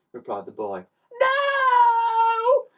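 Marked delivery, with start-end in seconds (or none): 1.2-2.7 high, loud desperate no; a despairing cry. A narrator's reading. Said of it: "cried the pair".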